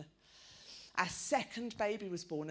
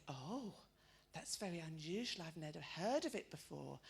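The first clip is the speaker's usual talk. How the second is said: put-on softer, lower puzzled old lady; a hint of a puzzled old lady; confused old lady; 5 semitones lower, 7 dB quieter than usual. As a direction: say it politely baffled.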